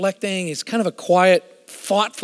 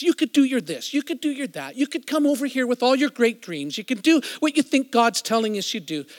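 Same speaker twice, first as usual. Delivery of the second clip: retold tirade; 6 semitones higher than usual. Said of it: "this huge tirade".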